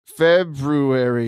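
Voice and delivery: "February" slowly as Feb-roo-ary; spelling pronunciation. Shown as a demonstration. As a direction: draw out "February", sounding the first r. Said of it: "It's definitely spelled February".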